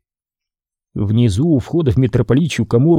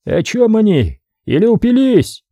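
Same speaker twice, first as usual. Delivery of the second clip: puzzled; 8 semitones higher than usual. A narrator's reading. Foreign helmsman puzzled.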